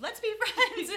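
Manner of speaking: high, loud giggling sing-song; playful high laughing "let's be friends"; laughing mock offer. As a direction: tease it as a gushing friend.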